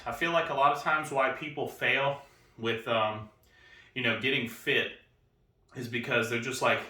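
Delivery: normal talking voice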